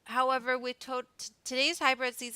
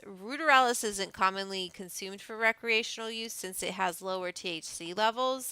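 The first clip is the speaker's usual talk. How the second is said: ordinary talk, nothing marked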